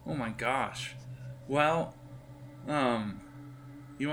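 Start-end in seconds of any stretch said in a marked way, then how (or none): none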